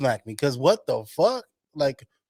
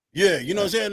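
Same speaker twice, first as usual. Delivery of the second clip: usual speaking voice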